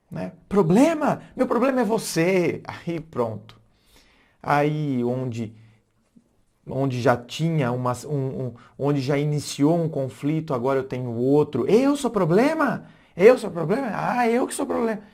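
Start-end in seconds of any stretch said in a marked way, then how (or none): 0.5-2.6 angry retort. Acted. Partner's heated comeback. 11.7-15.0 offended outrage. Acted. Offended partner escalates argument.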